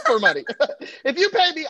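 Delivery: laughing, high and loud. Real, not acted. Joking to the club about money, laughing.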